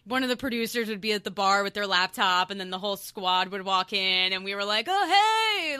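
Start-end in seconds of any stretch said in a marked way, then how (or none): 4.9-5.8 excited greeting. Retold or imitated. Whole squad walking into Applebee's.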